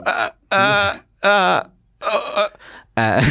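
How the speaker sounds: high, stuck "uh, uh"; panicked comic lost for words; flustered stammer. Pretend. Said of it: "do it to me and I'll go".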